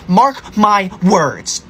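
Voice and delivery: very slow, word-by-word warning; slow threat. Acted. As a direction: space out each word as a solemn threat. Threatening classmate with detention.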